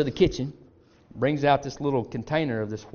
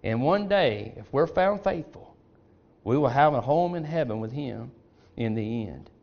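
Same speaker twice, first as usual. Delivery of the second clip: normal talking voice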